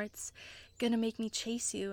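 ordinary talk, nothing marked

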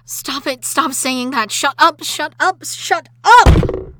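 from firm to screaming furious; building to a scream. Acted. Wife screaming at husband who called her a monster.

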